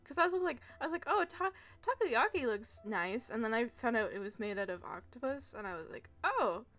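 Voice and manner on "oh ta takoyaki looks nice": ordinary talk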